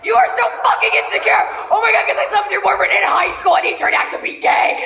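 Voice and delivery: hysterical rant with high-pitched outbursts; screaming meltdown. Acted. Sister fights to keep the family together, hysterical yelling. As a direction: rant frantically, breaking into shrieks.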